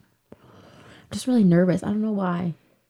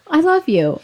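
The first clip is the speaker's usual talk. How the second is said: sweet high reply; 10 semitones higher, 3 dB louder, slower than usual. Retold or imitated. Retold sweet reply to sister.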